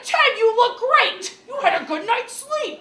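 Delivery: high, loud, slow laughing grandma voice; cheery grandma. Retold or imitated. Grandma complimenting the dad after brain surgery, high and laughing. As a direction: chirp it out like a delighted grandma. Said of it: "and she's like".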